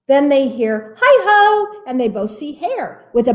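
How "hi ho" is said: high, loud hi ho; cheery call. Acted. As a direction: sing out a greeting at the door. Hare calls from door.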